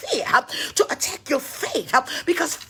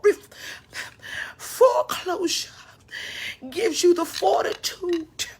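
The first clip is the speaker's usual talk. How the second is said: normal talking voice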